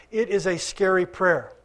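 usual speaking voice

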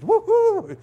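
high, loud hammed-up whoop; mock cheer. Pastor mocking instant transformation, high whoop. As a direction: celebrate like a cartoon.

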